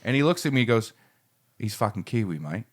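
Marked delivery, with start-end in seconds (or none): 1.6-2.6 put-on low, soft Australian accent. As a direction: imitate a laconic Australian mate. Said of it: "with an Australian fella".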